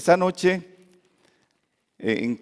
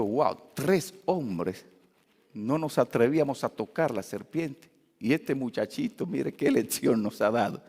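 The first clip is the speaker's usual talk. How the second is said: ashamed wonder; 6 semitones lower, 6 dB quieter than usual. Retold or imitated. Ashamed pastor marvels at boy.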